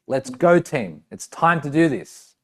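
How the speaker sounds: loud, punchy coach rallying the team; pep-talk coach voice; football coach. Shown as a demonstration. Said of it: "Think football coach".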